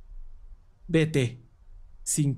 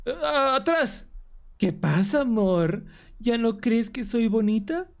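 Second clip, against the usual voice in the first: lightly higher excited shouts; excited comic yells; 7 semitones higher, slower than usual. Acted. Reading the comic's showdown aloud, a touch of excited yelling. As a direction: call out the fight lines eagerly.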